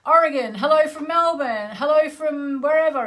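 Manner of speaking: lightly mimicking a stream of viewer greetings; imitating chat greetings. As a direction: rattle off the viewers' hellos like an eager chorus. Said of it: "everyone here going".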